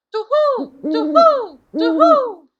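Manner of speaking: high, loud hooting owl; owl screech. A narrator's reading. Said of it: "three times screamed".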